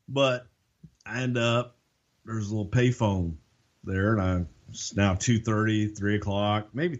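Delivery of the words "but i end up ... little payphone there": usual speaking voice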